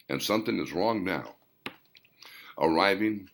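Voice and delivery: usual speaking voice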